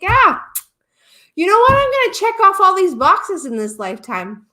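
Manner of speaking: high, loud, cocky eagerness; gung-ho enthusiasm. Acted. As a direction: play an overconfident soul raring to go. Voicing souls' boundless confidence.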